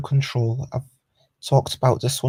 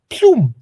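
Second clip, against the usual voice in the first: loud cartoon ploom, higher; ploom sound effect; 9 semitones higher, 8 dB louder than usual. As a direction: pop the sound out loud and high. Sound effect for moving piece.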